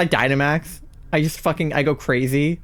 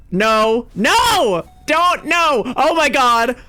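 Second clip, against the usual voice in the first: panicked pleading; 6 semitones higher, much slower than usual. Real, not acted. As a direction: beg the game not to hit. Boss attacking during raid.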